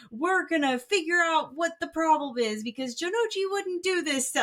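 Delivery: high, fast and earnest; eager loyal boy. Retold or imitated. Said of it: "Yugi's like".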